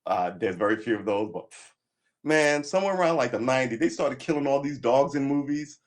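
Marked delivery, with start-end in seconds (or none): none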